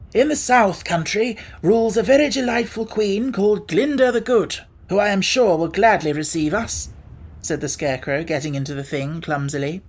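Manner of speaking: mildly lofty elder; lightly voiced Scarecrow. A narrator's reading. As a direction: counsel the newcomer wisely. Scarecrow suggests.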